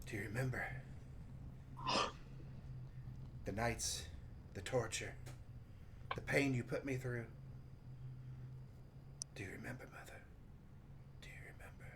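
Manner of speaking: soft mutter fading to a whisper; mumbling to himself. Acted. Salim recalling torture to his absent mother, muttered and fading. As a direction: murmur it haltingly, dying away to breath. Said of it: "he just mumbling to himself like that".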